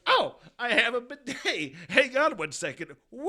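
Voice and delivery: high, loud giddy exclaim; gleefully announcing the bidet before using it; mock excited. Excited shout about his new bidet. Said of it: "I'm just like".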